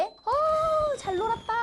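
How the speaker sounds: high, satisfied sing-out; contented child. Acted. Child finishing role play with parents, delighted. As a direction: let out a pleased, high-pitched exclamation.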